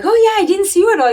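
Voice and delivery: high, quick surprised remark; surprised racer. Retold or imitated. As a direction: rattle it off in surprise. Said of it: "someone had asked me, like".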